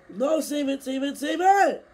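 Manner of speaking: loud, high, desperate yell; panicked shouting. Real, not acted. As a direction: shout it, pleading with the car. Car about to crash.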